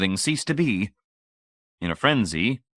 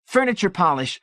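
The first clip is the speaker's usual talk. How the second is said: high-pitched excited squeal; screaming; 11 semitones higher, 4 dB louder than usual. A narrator's reading. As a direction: shriek it out high while dancing about. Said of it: "David screamed, dancing up and down".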